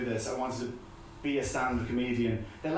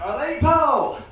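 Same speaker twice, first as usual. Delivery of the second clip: loud, much higher aggressive Scottish bark; booming mock Scottish accent, slowing at the end; shouting Scottish accent; 8 semitones higher, 7 dB louder than usual. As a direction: yell at the passenger like an angry Glaswegian. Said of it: "some Scottish accent".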